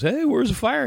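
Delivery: high, cocky cop voice; mock cop. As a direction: play the smug traffic cop.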